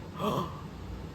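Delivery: quick voiceless gasp of shock; mock gasp. Shocked viewer at a killer reveal, mock gasp. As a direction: suck in a shocked breath, put on. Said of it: "and you're like".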